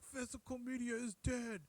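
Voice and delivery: high put-on voice proclaiming it; hammed-up high declaration; Rainier impression. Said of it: "And he's like".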